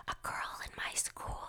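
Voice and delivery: hushed schoolgirl gossip whisper. Retold or imitated. Girl whispering gossip to another girl, whispering. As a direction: whisper it secretly, barely any voice. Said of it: "girl whispered to another girl".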